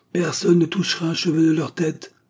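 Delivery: lightly voiced priest, high and ringing. A narrator's reading. Priest shields the refugees, high vibrant voice. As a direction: proclaim it like an organ note. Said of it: "d'une voix haute qui vibrait dans l'air".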